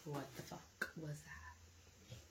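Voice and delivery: slow, breathless whisper of disbelief; hushed, drawn-out disbelief; stunned whisper. Retold or imitated. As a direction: whisper it slowly, as if still in shock. Repeats same words, now whispered.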